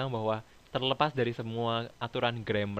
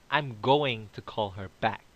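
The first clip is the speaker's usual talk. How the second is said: slowed model sentence, each word set apart; slow example sentence; 3 dB louder, much slower than usual. Shown as a demonstration. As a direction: say it slowly so the class can follow. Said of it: "teacher demonstrates example sentence". (contoh kalimat diucapkan pelan-pelan)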